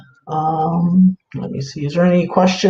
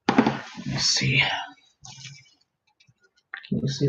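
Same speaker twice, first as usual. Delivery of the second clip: whisper; 11 dB quieter than usual. Real, not acted. Searching, talking under breath.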